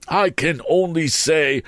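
high, pompous Mr Wopsle voice; fussy pompous man. A narrator's reading. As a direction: raise the pitch, puff it up.

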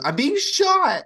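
high, loud, slow mock yelp; panicked cry. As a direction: squeal it like you're under fire. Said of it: "And then Bethany goes".

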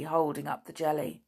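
ordinary delivery, nothing marked

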